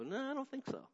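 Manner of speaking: skeptical pitch leap up; disbelieving, not buying it; a knowing don't-buy-it reply; doubting no. Retold or imitated. Doubting the fine answer.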